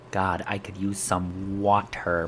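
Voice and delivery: slow, low line with a careful "water"; over-enunciated water. Shown as a demonstration. Streamer practising water in a sentence, over-enunciated "water". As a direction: drag out "water" with a crisp t and full r. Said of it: "can you say water".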